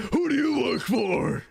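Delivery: slow, loud mock interrogation; villain voice. Playing villain for laughing friends, a slow mock-menacing shout. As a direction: demand it like a cartoon villain, drawn out. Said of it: "Have you heard [name]'s villain voice?".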